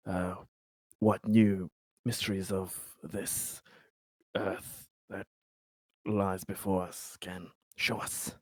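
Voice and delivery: ordinary talk, nothing marked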